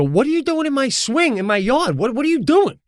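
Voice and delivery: high-pitched, indignant mock yell; retold angry confrontation. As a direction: act out scolding a trespasser, voice up high. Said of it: "I was definitely more mad".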